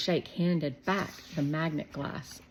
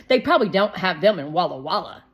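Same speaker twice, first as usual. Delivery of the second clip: put-on fast, louder smug boy; Shermie, smug kid; 10 dB louder, faster than usual. A narrator's reading. Shermie teasing Shake about Walla Walla, fast smug. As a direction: rattle it off like a know-it-all kid.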